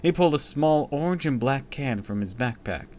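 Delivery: plain reading voice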